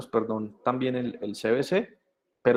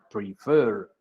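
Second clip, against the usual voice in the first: PREFER with a long, stressed FER; second-syllable stress; 2 semitones lower than usual. Shown as a demonstration. Teacher repeats the example, PREFER stressed on its second syllable. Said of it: "subo la voz en fer".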